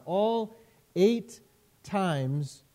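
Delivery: ordinary talk, nothing marked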